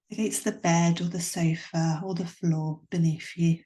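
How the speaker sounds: calm yoga nidra voice; guided meditation. Acted. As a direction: ease the listener into stillness. Leads a guided relaxation.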